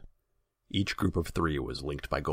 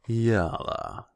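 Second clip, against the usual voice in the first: loud, high drawn-out purr; oily, purred greeting from a ruler; 8 semitones higher, 6 dB louder than usual. A narrator's reading. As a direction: purr the name slowly at a subject. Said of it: "he purred".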